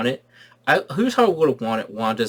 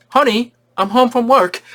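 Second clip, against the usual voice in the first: higher, louder cheery sitcom greeting; sitcom dad; 11 semitones higher, 7 dB louder than usual. Retold or imitated. Vision coming home, cheery sitcom call.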